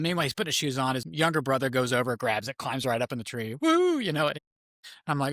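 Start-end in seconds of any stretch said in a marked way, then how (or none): 3.6-4.0 high, loud "woo"; retold cheer; triumphant whoop. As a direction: whoop like a kid who made it. Younger brother climbs right up.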